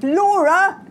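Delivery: loud, high cry of exasperation; exasperated shout. A narrator's reading. Twins protest at their sister, exasperated shout. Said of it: "the twins shouted".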